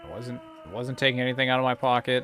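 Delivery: alarmed protest. Real, not acted. Player protests at gunpoint.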